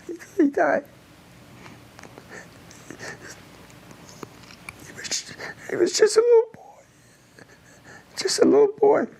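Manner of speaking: high, strained near-whisper of grief; choking back tears, barely getting it out; voice breaking. Real, not acted. Veteran recalling the boy's death, voice breaking. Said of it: "I get a little emotional".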